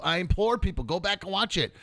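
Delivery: ordinary talk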